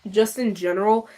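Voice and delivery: usual speaking voice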